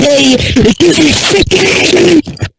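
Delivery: loud, high, slow exasperated shout. Real, not acted. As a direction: drag it out in loud frustration. Ranting about spelling.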